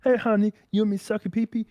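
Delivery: high, loud mocking girlfriend. Man mocking his girlfriend's come-on in a high voice. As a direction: chirp it like a clingy partner. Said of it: "and you're like".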